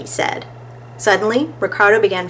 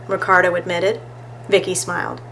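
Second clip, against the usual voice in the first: ordinary delivery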